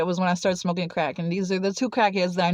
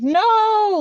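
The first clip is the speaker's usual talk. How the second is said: high, loud, drawn-out cry of no; panicked protest; 12 semitones higher, 6 dB louder than usual. Retold or imitated. Thrown into the jail cell, panicked protest. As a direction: wail it in retold dread.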